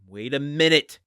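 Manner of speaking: high, loud double-take; startled, loud interruption; sudden realization. Real, not acted. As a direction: burst out as the penny drops. Sudden suspicion while reading.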